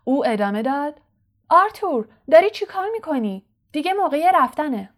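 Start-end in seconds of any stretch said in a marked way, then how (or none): 1.5-4.9 high, raised urgent voice; impatient calling. Acted. Mrs Weasley summons her husband to the departing train, impatiently. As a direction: scold him into hurrying up.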